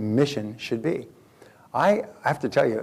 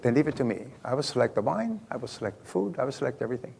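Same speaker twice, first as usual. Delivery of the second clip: softly voiced Italian waiter, lightly accented; understated Italian-accented waiter, soft and assured; 6 dB quieter, faster than usual. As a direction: take charge quietly with a hint of Italian accent. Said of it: "he had an Italian accent".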